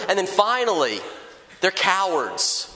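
normal talking voice

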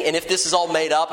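ordinary talk

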